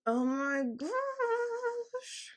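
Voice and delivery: very high, drawn-out squeal; overwhelmed squeal. Real, not acted. Podcaster overwhelmed by directions, squealing. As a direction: stretch it into a long, high-pitched whine. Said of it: "not sure what that was about".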